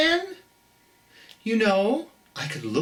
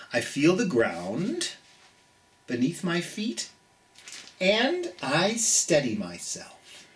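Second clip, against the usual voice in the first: gentle storybook reading for little ones; reading to children; 3 semitones higher than usual. Acted. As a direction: read it like bedtime story time. Reads the picture book aloud.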